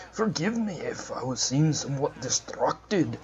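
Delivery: put-on a first stab at a Scottish accent on Forgive me. The player voicing the priest to his companions in a Scottish accent. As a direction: roll into a Scottish lilt for the priest. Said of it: "Gotta get that Scottish accent going".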